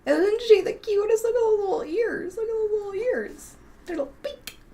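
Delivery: high-pitched doting pet voice; high, gushing puppy talk. Real, not acted. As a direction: coo over an adorable little dog. Cooing over her dog.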